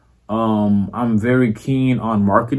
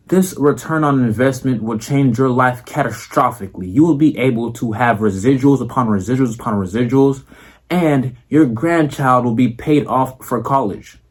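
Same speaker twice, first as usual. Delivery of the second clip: formal boardroom speech, deliberate and proper; educated businessman voice. Shown as a demonstration. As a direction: pitch an investor like a smooth executive. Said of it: "if I talk like this".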